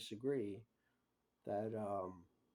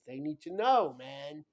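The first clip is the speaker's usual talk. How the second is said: insistent; 3 semitones higher, 10 dB louder than usual. Real, not acted.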